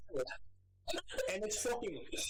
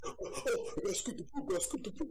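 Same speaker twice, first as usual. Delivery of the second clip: higher, fretting sing-song about scooping; making fun of a fussy cat owner, in a higher voice; fussy Anthony impression; 9 semitones higher than usual. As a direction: mimic a friend panicking over cat poop. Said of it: "He's like".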